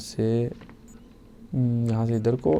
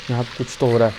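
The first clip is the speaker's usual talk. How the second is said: ordinary talk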